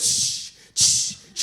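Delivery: loud bursts of shushing hiss; imitated rushing noise. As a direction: mimic ice tumbling into the tray. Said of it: "they hear this noise".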